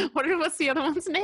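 laughing. Real, not acted. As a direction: giggle while you ask it. Laughing while recalling name.

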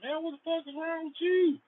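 very high-pitched mock-indignant squeal; high, incredulous voice acting out a comeback. Acted indignant reply to friend.

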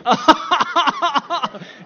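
loud, high-pitched exaggerated sobs; mock sobbing. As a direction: burst into hammed-up uncontrollable sobbing. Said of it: "I started sobbing through the whole".

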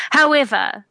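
declaiming. Acted. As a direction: announce HOWEVER loud and high. Player's in-character speech, restarted.

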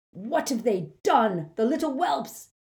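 screamed. A narrator's reading. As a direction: scream it over his sister. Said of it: "he screamed".

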